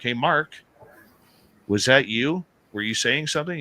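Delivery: high-pitched call across a distance; retold calling out. Yelling over at Mark about the noise, mock holler. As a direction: re-enact hollering over to a friend. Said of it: "So we yell over at Mark".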